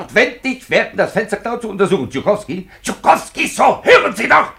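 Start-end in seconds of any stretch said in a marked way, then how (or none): 2.1-4.5 high, loud call across the room. Acted. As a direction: call him over sharply.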